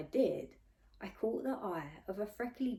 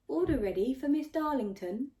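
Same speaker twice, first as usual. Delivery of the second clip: higher, louder announcement with a rising end; digital assistant; 7 semitones higher, 6 dB louder than usual. A narrator's reading. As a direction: call the order like a cheerful machine. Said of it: "the digital assistant".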